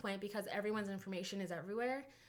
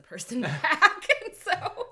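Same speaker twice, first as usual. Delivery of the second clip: laughing; 7 semitones higher, 13 dB louder, much slower than usual. Real, not acted. Both laughing at doxing story.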